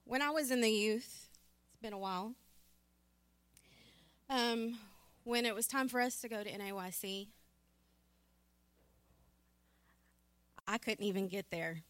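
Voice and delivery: real emotion, halting with long pauses; emotional. Real, not acted. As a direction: hold back the feeling, pausing to gather yourself. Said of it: "Sorry if I'm a little emotional".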